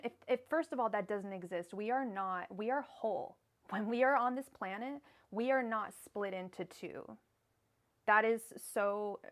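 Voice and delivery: loud, emphatic outburst of real exasperation; heated, near yelling. Real, not acted. Tarot reader railing against twin flames to a client, heated, near yelling. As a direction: raise your voice in a passionate tirade. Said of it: "I'm not yelling at you".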